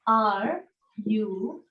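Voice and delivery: ordinary delivery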